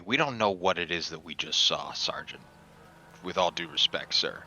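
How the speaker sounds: usual speaking voice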